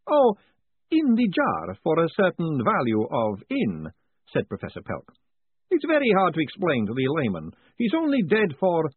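brisk, high, chatty academic; high professor voice. Acted. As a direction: lift the pitch for the cheerful professor. Pelk explaining.

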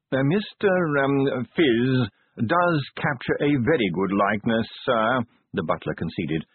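discreet, proper manservant voice; polite butler. Acted. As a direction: deferential butler, gently warning. Butler conceding.

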